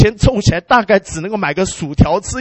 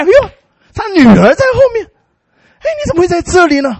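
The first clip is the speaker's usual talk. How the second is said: much higher and louder, astonished; startled surprise; 9 semitones higher, 5 dB louder than usual. Retold or imitated. John turns and sees his daughter, loud and startled. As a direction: gasp it out in disbelief.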